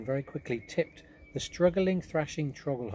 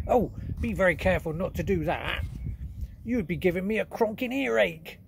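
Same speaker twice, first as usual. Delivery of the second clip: higher, louder giant warning; worried giant; 7 semitones higher, 5 dB louder than usual. Acted. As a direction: warn her off as the big giant. BFG warns Sophie.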